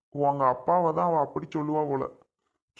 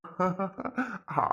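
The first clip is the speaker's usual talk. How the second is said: amused chuckle; laughing; 4 semitones higher than usual. Acted. Father amused by daughter's question, laughing. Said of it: "சிரிக்காம சொல்லுங்க".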